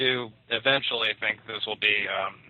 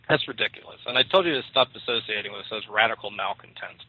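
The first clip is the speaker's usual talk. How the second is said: usual speaking voice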